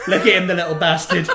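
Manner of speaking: loud, fast, laughing mock yell; mock shouting mum. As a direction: shout it like a fed-up mum in a shop. Mocking a shop mum.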